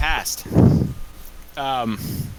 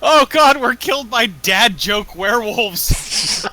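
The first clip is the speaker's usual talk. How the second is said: laughing exasperation; 6 semitones higher, 5 dB louder, slower than usual. Real, not acted. Laughing despair.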